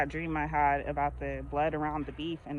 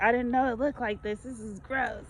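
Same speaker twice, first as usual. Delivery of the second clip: mock grossed-out son; 7 semitones higher than usual. Son shocked by raw chicken.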